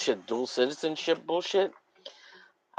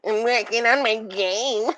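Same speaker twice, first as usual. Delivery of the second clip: mocking Matthew; 10 semitones higher than usual. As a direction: tease him in a higher nasal-free mock voice. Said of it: "says Matthew".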